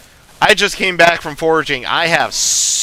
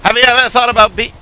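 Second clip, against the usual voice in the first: fast, louder attempt at a character; failed character voice; 6 semitones higher, 5 dB louder, much faster than usual. Shown as a demonstration. Trying a character's line too soon, fast and loud. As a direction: rush the line in a put-on voice. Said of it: "that was rough".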